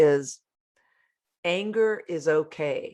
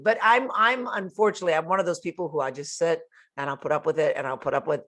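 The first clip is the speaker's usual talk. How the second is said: ordinary delivery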